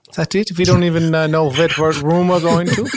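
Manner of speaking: ordinary talk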